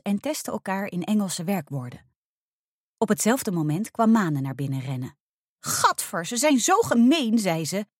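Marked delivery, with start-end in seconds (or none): 5.6-7.4 upset, high and louder outburst; indignant, as if about to cry; close to tears. A narrator's reading. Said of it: "Haar stem trilde alsof ze op het punt stond te huilen". (verontwaardigd, bijna in tranen)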